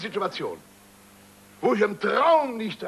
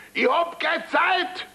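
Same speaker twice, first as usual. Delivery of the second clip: mock bellow; 12 semitones higher, slower than usual. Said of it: "Er brüllt mich an und sagt".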